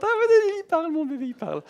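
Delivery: very high, loud thrilled squeal; shrieking with joy over the baby; delighted mother. Retold or imitated. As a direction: gush like a proud mother. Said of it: "la mère est toute contente".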